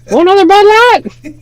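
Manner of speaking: squeaky falsetto onlooker; imitated high-voiced guy. Streamer spoofing the onlooker after the jump, falsetto mimicry. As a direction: call it out in a very high mock voice. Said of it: "that don't have the deep voice".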